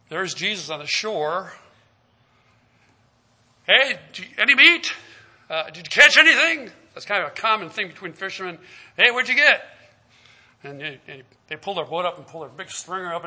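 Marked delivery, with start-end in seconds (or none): 3.7-6.7 raised, higher call across the water. Acted. Jesus calling to the fishermen across the lake, loud. 8.9-9.7 raised, higher friendly hail; calling out. Acted. Fisherman hailing another boat, loud and friendly.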